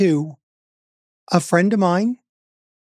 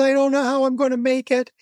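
imitating tears; 7 semitones higher, faster than usual. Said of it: "And she said".

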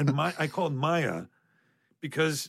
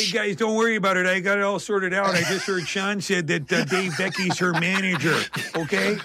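put-on louder, higher folksy Midwest accent; 5 semitones higher, 3 dB louder than usual. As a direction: play a folksy Midwest local. Joins in the hick voice bit.

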